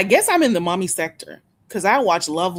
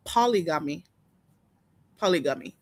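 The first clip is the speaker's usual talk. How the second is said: low Haitian-accented "Polygamy"; 3 semitones lower than usual. Shown as a demonstration. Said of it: "That's how I say it".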